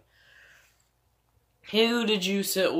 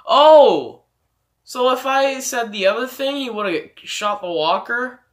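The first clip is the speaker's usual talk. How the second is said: surprised; 6 semitones higher, 9 dB louder than usual. Real, not acted. Realizes another choice outcome.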